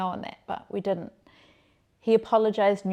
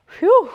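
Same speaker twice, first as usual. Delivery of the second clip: high, loud "phew"; exaggerated whoosh of relief; relieved phew; 13 semitones higher, 15 dB louder than usual. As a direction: blow out a big, high phew. Acting out relief at news.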